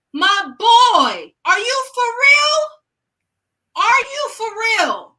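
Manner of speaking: high, loud, stretched-out disbelief; outraged disbelief. Retold or imitated. Reacting to the grieving dad, loud incredulous outburst. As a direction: shout it at him, can't believe it.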